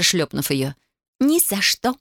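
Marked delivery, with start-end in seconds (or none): 1.2-2.0 high, singsong refusal. A narrator's reading. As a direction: chant it teasingly at your sister. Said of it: "пропела Татьяна".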